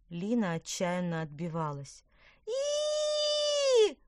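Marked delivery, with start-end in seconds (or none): 2.4-4.0 long, piercing squeal; loud drawn-out "eee" shriek; high girlish squeal. A narrator's reading. Girl pelted with peas, piercing squeal. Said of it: "визжала девочка".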